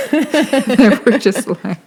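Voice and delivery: words squeezed out through laughter; laughing. Real, not acted. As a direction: laugh while you say it.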